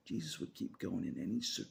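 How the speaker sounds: ordinary delivery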